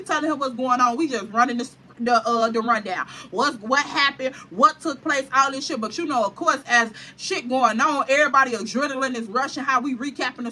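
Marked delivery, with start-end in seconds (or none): none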